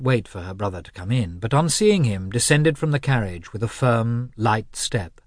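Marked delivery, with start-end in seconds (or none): none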